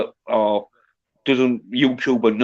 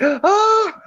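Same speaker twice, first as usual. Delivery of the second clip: high-pitched imitated gasp of shock; mock gasp. Imitating onlookers as the head is chopped off, mock shocked gasp. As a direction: gasp like a horrified crowd. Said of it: "they're all gasping".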